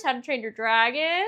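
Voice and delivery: usual speaking voice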